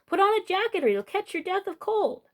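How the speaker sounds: high, urgent calling after; fretting call. Acted. Bubby chasing the boy out the door, high fretful call. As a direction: call out like a worried grandmother.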